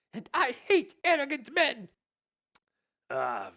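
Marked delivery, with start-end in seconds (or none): 0.1-1.9 Elsa voice. Acted.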